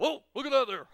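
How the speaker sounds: loud, high exclamation in character; big presidential wonder; President Obama. Acted. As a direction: marvel loudly as the visiting dignitary. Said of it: "President Obama picks up".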